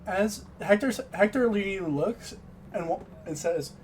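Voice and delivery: ordinary talk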